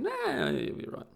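mock brush-off, pitched high and drawn out; dismissive reassurance. Talking himself out of the warning, mildly dismissive. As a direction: wave the worry away in a slow, lifted voice. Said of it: "and I was thinking".

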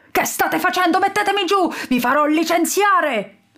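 high, mock-furious protest; furious yell. A narrator's reading. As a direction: rant helplessly, hammed up. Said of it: "urlava su tutte le furie Lord Panzone". (urlo furioso, acuto)

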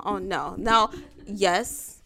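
usual speaking voice

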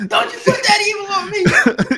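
very high, half-laughing mock cry; hammed-up wail. Co-host quotes a classic line to Ricky Bobby, high mock wail.

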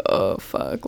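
low, soft dread; dreading groan. Retold or imitated. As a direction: sink down into a muttered oh no. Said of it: "even when I'm like".

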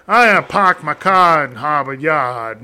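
slow stock Boston accent line; hammed-up Boston phrase. Shown as a demonstration. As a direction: drop the R's like a Bostonian. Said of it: "with a Boston accent".

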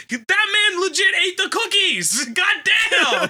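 high, loud exclaiming disbelief; laughing outrage. Real, not acted. Laughing at grandpa pretending about the cookies, loud and high. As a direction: shout it out, laughing at the absurdity.

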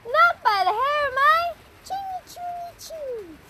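high squeaky little pig. A narrator's reading. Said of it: "cried the first pig".

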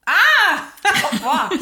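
high yelp breaking into laughter; loud laughing outburst. Real, not acted.

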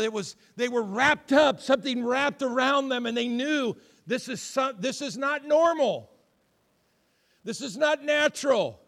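loud, high, fervent preacher delivery; preaching at full force. Acted. Pastor driving home the story to the congregation, loud fervent preaching.

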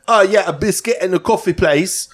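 high mock British accent ordering; light British accent at the counter. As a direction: toff British voice asking politely. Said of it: "he's fucking British".

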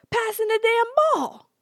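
high and loud, in disbelief; incredulous loud exclamation. Real, not acted. To viewers, amazed grown men pass a ball, loud and high.

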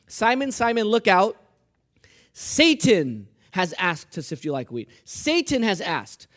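loud, slow reading with punched key words; contrast emphasized. Acted. Preacher reading verses to show the contrast, emphatic and slow. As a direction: pit "Satan" against "I", loud and deliberate. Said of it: "Feel the contrast here".

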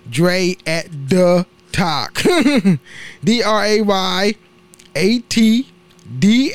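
slow, word-by-word "Dre at the Talk"; slowly spelled-out handle. Real, not acted. Repeats handle slowly for listeners.

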